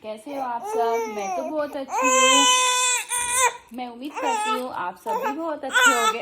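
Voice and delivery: put-on high squeaky toddler voice, whiny and excited; excited baby greeting the camera; cutesy baby voice. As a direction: speak as the baby, high and babyish. Mother voicing baby's greeting.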